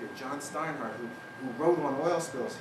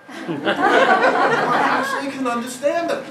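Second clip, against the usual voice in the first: laughing; 13 semitones higher, 12 dB louder, much slower than usual. Real, not acted. Audience laughing at the joke.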